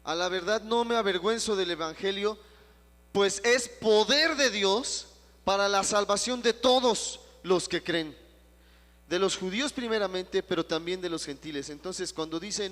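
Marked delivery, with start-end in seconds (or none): 0.0-11.7 loud preaching read; scripture reading. Acted. Pastor reading the verse aloud to the congregation, loud. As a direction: declaim the verse to the church.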